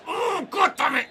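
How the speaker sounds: high, loud, drawn-out angry yell; furious shout. Real, not acted. Gamer yelling at the ref's decision, high furious shout. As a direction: explode at the bad call. Frustrated at a foul call.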